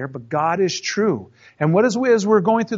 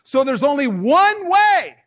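high, slow, louder mocking singsong; mock controller; 8 semitones higher, 5 dB louder, slower than usual. Preacher mimicking the tower, high slow mockery. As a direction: parody the one-way insistence, drawn out high.

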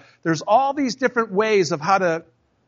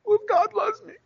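much higher whiny objection; mock skeptic. Preacher voicing a skeptic's complaint, high whiny. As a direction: voice the doubter, pitched up.

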